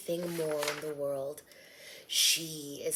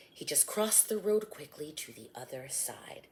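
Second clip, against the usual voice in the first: normal talking voice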